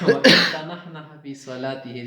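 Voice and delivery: loud recited Arabic phrase; Arabic text recitation. Shown as a demonstration. Teacher reciting the Arabic source loudly. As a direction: declaim the text as read in class. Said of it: "جیسے کہتے ہیں نا،".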